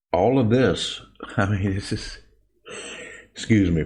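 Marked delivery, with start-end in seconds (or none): 1.2-2.2 quiet, stifled real chuckle; trying not to laugh. Real, not acted. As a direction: start softly, holding back a real laugh. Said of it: "I'm trying not to laugh".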